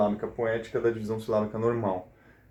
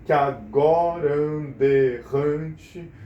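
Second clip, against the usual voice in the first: slow verse with vowels run together, "agorande"; joined-syllable recitation; 4 dB louder, much slower than usual. Shown as a demonstration. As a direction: link the words into five slow, strong beats. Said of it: "você junta a sílaba".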